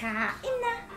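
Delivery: excited call. Real, not acted. Calling everyone to eat.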